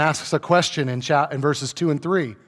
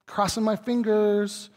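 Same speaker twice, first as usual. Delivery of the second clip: higher, slow, unsure mock voice; mock wishful hope; 6 semitones higher, 3 dB louder, slower than usual. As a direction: play a doubter only hoping, drawn out. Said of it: "It's not".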